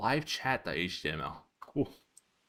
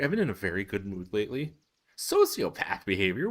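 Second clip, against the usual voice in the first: ordinary talk, nothing marked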